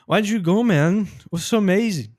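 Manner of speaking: "Why did you go" slurred into "why-joo-go", "amazing" cut to "amazi"; jumbled pronunciation. Shown as a demonstration. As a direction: guess at it, mumbling "It was so amazing" into one blur. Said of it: "Não, tá embolado".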